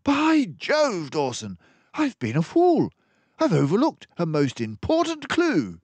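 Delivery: detective suddenly realizing his mistake; excited outburst. Acted. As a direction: jump up as the penny drops. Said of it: "Basil leapt excitedly from his chair".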